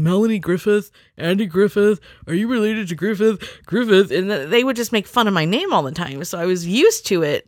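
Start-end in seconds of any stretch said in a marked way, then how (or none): 0.0-4.1 mock teasing people. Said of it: "people saying".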